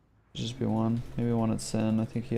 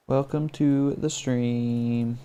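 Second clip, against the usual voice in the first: slow drawn-out welcome; much slower than usual. Real, not acted. Greeting new follower on stream.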